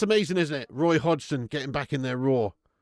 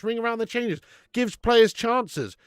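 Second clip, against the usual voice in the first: usual speaking voice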